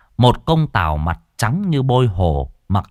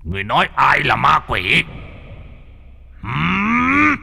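rising, menacing demand from a glaring official; stern demand; 7 semitones higher than usual. A narrator's reading. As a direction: glare and demand who he means. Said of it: "quắc mắt".

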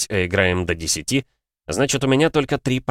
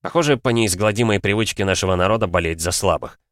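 usual speaking voice

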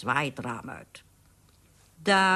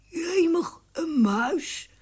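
startled thief's exclamation; can't believe it's a mouse; amazed; 12 semitones higher, much slower than usual. Acted. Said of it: "zei de ene boef verwonderd".

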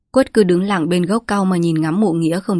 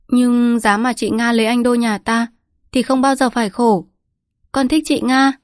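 con gái nói; 5 semitones higher than usual. A narrator's reading. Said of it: "hỏi bố".

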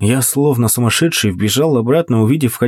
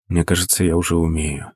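low, soft moaning words; 7 semitones lower, 5 dB quieter than usual. Acted. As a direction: moan it softly in bliss. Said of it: "простонал я".

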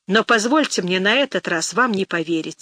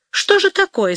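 high, sharp startled question; a stung, surprised retort; slightly offended; 11 semitones higher, 4 dB louder than usual. A narrator's reading. Girl piqued by suitor's talk of money, offended surprise. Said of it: "несколько неприятно задетая".

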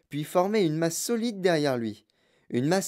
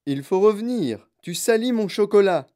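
louder, higher protest; raised voice, upset and insistent; alarmed cry; 6 semitones higher, 6 dB louder than usual. A narrator's reading. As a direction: cry out urgently to make him stop. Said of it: "cria Monsieur Wonka".

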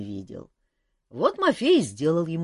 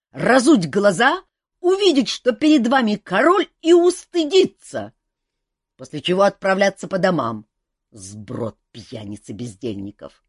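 angry king; 5 semitones higher, 7 dB louder than usual. A narrator's reading. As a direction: tear into the drunks. King scolds drunk subjects.

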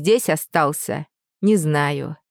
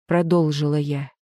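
usual speaking voice

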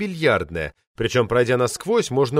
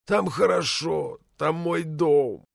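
slow, softer tearful plea; drunken weeping; 3 semitones higher, 4 dB quieter, much slower than usual. A narrator's reading. Drunk poet tearfully pleads to be taken home. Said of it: "заплакал Влад".

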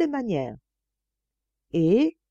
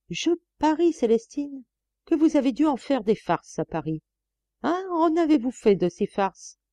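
higher, eager teasing exclamation; leering exclamation; 8 semitones higher than usual. A narrator's reading. Master teases the chambermaid, leering and eager. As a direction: cry it out with a leer. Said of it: "il s'écria".

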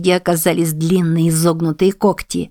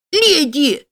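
very high, straining call; desperate call; 10 semitones higher than usual. A narrator's reading. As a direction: call with the last strength. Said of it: "из последних сил звал заяц".

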